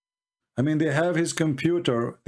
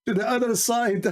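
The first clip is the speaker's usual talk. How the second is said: said high through giggles; words breaking into laughter; laughing through words; 8 semitones higher than usual. Real, not acted. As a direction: still laughing at the joke. Laughing while finishing the joke.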